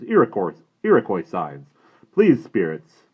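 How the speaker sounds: put-on loud, stern brave scolding the boy; a proud warrior unimpressed by fuss; disapproving Indian brave. Indian and Omri dialogue.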